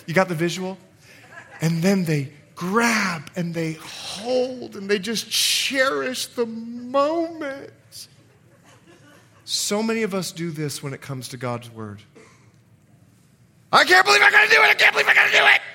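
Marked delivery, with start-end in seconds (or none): 2.6-7.7 very slow, drawn-out words; hammed-up romantic relish. Acts out new love embrace. 13.7-15.6 very high, loud whining shout; mock childish tantrum. Mocks reluctance toward God's word.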